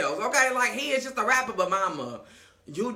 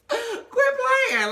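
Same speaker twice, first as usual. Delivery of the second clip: gasping high squeal of disbelief; shocked gasp; 3 dB louder than usual. Retold or imitated. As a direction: gasp and shriek it, can't believe it. Amara shocked by gift.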